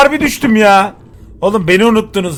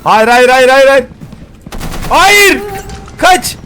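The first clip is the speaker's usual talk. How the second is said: being chased; 5 semitones higher, slower than usual. Real, not acted. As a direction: scream no while running away. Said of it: "Çok korkuyorum". (kovalanırken korkuyla bağırma)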